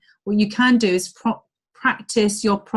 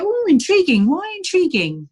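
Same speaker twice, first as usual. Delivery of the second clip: high-pitched, playful echo of interest; acted curiosity; 10 semitones higher, 3 dB louder than usual. Coach acts replying to a curious stranger, playful curiosity. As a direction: pitch it way up, as if very intrigued.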